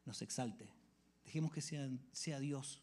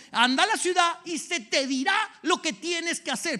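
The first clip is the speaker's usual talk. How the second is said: high, loud fervent preaching; preaching at full force; 13 semitones higher, 12 dB louder than usual. Acted. Preacher pressing the call of Christ on the church, fervent. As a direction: drive it home with fire.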